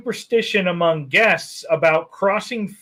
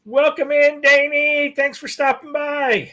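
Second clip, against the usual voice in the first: cheerful welcome; 8 semitones higher than usual. Real, not acted. Greeting a guest on stream.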